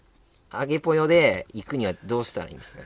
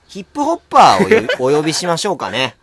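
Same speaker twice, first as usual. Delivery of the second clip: announcer voice; 5 semitones higher, 9 dB louder than usual. Acted. 司会風に次を呼び込む.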